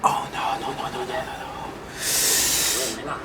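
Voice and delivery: breathy, hushed string of no's; near tears. Real, not acted. Watching a painful flashback, whispering near tears. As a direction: whisper "no" over and over, fighting tears. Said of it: "I have tears".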